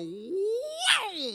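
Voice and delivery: loud, high startled cat yowl. Retold or imitated. Son startled by dead father, yowling like a cat. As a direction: screech it like a frightened cat. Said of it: "like a cat".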